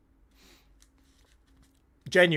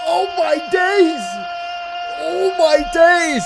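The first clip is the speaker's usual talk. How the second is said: high, slow, shouted disbelief; ecstatic cry; 9 semitones higher, 7 dB louder, slower than usual. Real, not acted. Streamer reacting to the goal for chat, shouting in joy. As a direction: let it burst out at the goal.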